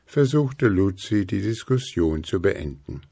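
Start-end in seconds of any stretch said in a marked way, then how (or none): none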